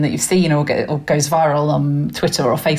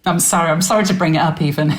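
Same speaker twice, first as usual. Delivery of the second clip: ordinary talk, nothing marked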